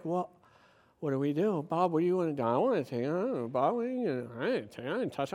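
low, soft mock dithering; bewildered muttering. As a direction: mimic confused men mumbling among themselves. Acts officers' confused discussion.

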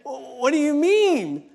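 jumping up high and loud in retold disbelief; replaying his shocked reaction, pitched well up; startled whoa. To the man in line, startled and loud. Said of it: "and I'm just like".

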